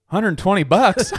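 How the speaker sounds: high, loud, drawn-out disbelief; mock shock. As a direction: act floored by the total at checkout. Retelling stoned customer's checkout shock.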